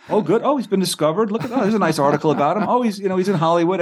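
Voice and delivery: high, pleased running reaction; mock delight. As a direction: act out a hopeful reader cheered by each discovery. Acting out his hopeful reading.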